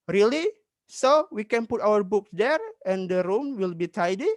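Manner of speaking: high, rising, acted surprise; surprised question. Girl hearing Mom will buy a bookshelf, acted surprise. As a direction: question the good news in a lifted voice. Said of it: "Dan Ratu terkejut".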